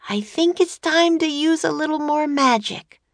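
high, bright little sister calling Jack; girl's voice. A narrator's reading. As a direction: pitch up high for the young girl.

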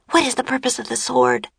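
put-on high, quick child's voice; Annie asking. A narrator's reading. Annie turning to Jack for help, asking quickly. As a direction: ask it fast and urgently, pitch up. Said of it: "she asked".

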